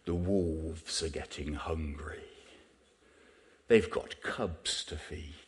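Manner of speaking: slow, hushed nature-film narration; mellifluous documentary narrator. Retold or imitated. Preacher mimicking a wildlife documentary voice-over, mellifluous and hushed. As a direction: imitate a mellifluous TV naturalist, low and unhurried. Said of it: "in his mellifluous voice".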